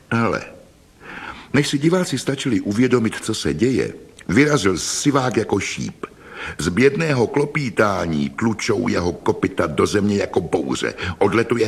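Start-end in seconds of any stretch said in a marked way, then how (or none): none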